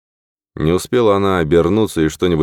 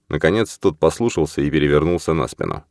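ordinary delivery, nothing marked

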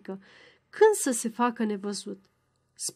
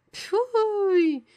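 high, drawn-out "phooey"; disgusted pfui; 9 semitones higher than usual. A narrator's reading. Professor shows scorn for the renegade, disgusted pfui. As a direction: spit out disgust in one falling cry.

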